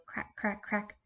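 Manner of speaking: very soft, playful crack crack crack; soft crack sounds. Shown as a demonstration. Teacher miming eggs, soft playful cracks. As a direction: tap out each crack gently. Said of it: "acting out cracking eggs".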